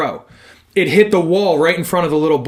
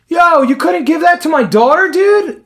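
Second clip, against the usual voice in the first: higher, louder mock outrage; indignant dad; 8 semitones higher, 4 dB louder than usual. Dad scolds a fan over a baseball, loud mock outrage. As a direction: imitate an entitled dad's complaint. Dad retold complaining loudly.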